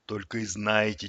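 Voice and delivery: low, weary resignation; heavy sigh. A narrator's reading. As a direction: sigh it out, fed up. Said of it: "тяжело вздохнул хомяк". (тяжело вздыхая)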